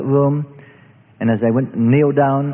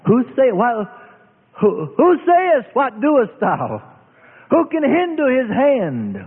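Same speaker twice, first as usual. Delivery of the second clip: high, slow, ringing preacher's cry; drawn-out pulpit call of scripture; scripture proclamation; 9 semitones higher, slower than usual. Acted. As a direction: lift the verse up slowly like a prophet.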